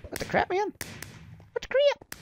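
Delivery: very high squeaky outburst; high-pitched gamer indignation; baffled squeal. Real, not acted. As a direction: squeal it in disbelief. Gets hit in the game.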